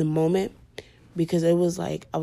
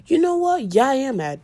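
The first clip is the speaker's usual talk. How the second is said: high, louder, sassy snap; sassy admission; 8 semitones higher, 6 dB louder than usual. Retold or imitated. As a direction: flip from denial to owning it. Imagined snap of admitting anger.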